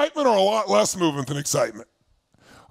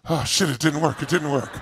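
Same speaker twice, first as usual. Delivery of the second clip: low deflated sighing; a limp, defeated try; half-hearted flop; 6 semitones lower than usual. Retold or imitated. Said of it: "They'll go".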